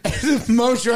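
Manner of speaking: normal talking voice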